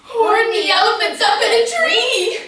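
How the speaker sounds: high, loud laughing jeer; mock him with laughing shouts; gleeful mocking shout. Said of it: "they shouted with glee".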